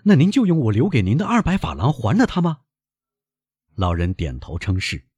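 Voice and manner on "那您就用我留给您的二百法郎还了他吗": high, agitated questioning; appalled son. Acted. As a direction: question your father in disbelief, pitch raised.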